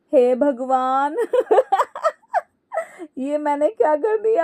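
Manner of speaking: regret it while laughter keeps bursting out; dismayed laughing. Acted. Daughter-in-law realising her blunder, lamenting through laughter. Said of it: "फिर से हंसने लगती है".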